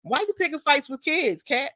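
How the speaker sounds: mock incredulous question.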